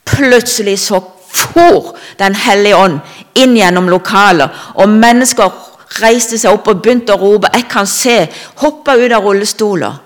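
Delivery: rousing proclamation. Retold or imitated. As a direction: let the voice burst out as the room erupts. Retelling sudden outbreak in meeting.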